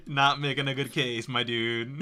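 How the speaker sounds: ordinary talk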